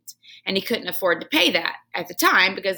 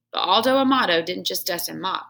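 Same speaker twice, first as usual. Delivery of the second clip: plain reading voice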